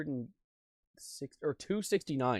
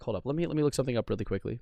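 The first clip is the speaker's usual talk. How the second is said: ordinary talk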